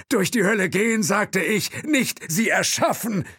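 high, loud, sharp rebuke; Thompson's voice. A narrator's reading. Thompson rebukes the chauffeur in a high, loud voice. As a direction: scold him in a high voice.

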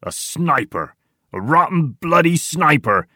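alarmed shout. A narrator's reading. Tiny warns of a sniper as he drops down, rising shout. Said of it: "shouts Tiny".

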